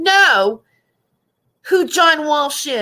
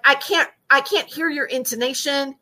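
ordinary talk

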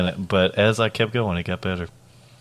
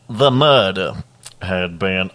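put-on Southern accent. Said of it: "This is a great Atlanta accent".

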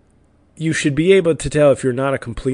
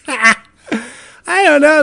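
laughing; 14 semitones higher, 4 dB louder, much slower than usual. Real, not acted. Laughing at his own joke.

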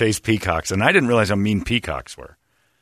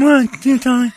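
much higher put-on voice; Charlie impression; 13 semitones higher, 3 dB louder than usual. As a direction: imitate Charlie's accent. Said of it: "use your accent as your impression".

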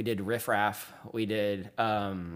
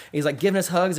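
normal talking voice

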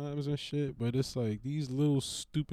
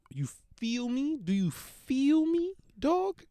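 rising exasperation; 10 semitones higher than usual. Retold or imitated. Owner pleading with the dog, voice climbing higher.

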